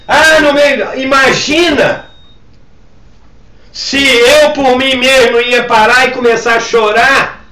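high, emphatic sermon voice; forceful preaching. Real, not acted. Pastor testifying that he took on her pain, forceful preaching. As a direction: drive the testimony home with intensity.

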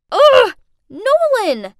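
loud, high exasperated outburst; angry yell. Acted. Woman waking among gulls yells at her prankster ex, angrily. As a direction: yell the culprit's name in outrage.

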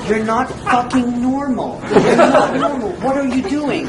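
husband voicing his wife, caught on the floor at four, laughing exasperation; exasperated wife. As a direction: drag out a half-laughing scolding as the wife. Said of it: "my wife is going".

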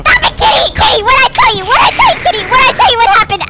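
loud, high exasperated telling-off; scolding yell. Pretend. Dad telling off the attacking kitty, scolding shout. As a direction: scold the pet at full voice.

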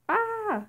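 high, loud startled scream; a short, high yelp of fright. Acted. Startled by a sudden scare in the game, screaming. Startled during Minecraft role-play.

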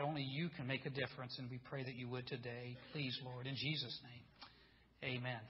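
soft, low prayer voice. Real, not acted. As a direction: pray quietly and humbly before the congregation. Leads the congregation in prayer.

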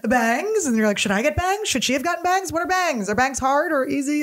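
high-pitched panicked questioning; mock shock. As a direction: spiral into hammed-up alarm over a haircut. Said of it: "you have to be shocked by bangs".

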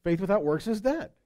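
normal talking voice

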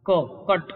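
"ક" and "કટ" said with a short, clipped k vowel; short k sound. Shown as a demonstration. Teacher showing the short "ક" sound in cut. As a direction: keep the "ક" in "કટ" short. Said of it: "ક નો ટૂંકો ઉચ્ચાર".

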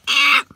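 short, loud yelp; cry of pain. A narrator's reading. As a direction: yelp as you trip and fall. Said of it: "she cried".

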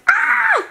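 very high burst of a thrilled scream; excited scream. Retold or imitated. Recalling seeing the measured wall space, a thrilled scream. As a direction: let out a delighted shriek. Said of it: "and I'm like".

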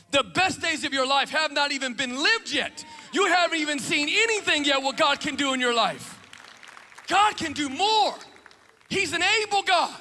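shouted, rising preacher's cry; fiery preaching. Acted. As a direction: preach it out loud to the church. Preaching at full force.

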